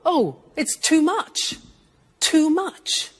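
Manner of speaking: high, rising "oh, it's too much"; mock surprise. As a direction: act overwhelmed by the excess. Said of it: "and you say".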